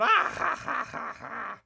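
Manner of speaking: sharp high burst of laughter fading out; mocking laugh. Real, not acted. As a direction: laugh at the absurdity. Mocking laugh at trade war.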